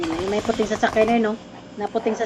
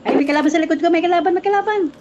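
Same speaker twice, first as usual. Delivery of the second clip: combat callout; 8 semitones higher, 4 dB louder than usual. Real, not acted. Spotting an enemy in game.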